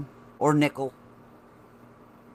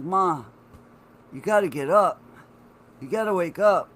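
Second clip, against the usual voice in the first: urgent call to mom; 5 semitones higher, 5 dB louder than usual. Retold or imitated. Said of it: "and I'm like".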